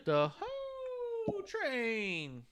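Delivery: drawn-out, high wrestling entrance call; mock Godfather call. Host announcing the Ho Train like the Godfather, slow and high. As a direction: stretch it like a ring announcer's hype.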